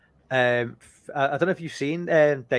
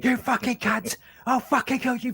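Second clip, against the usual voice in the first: imitating angry Ray Winstone; 10 semitones higher than usual. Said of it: "it's just like".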